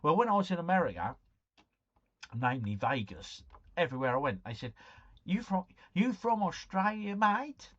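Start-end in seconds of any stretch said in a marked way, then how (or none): 5.2-7.7 put-on high-pitched Australian accent, rising question; mock Australian accent. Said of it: "that's an Australian accent".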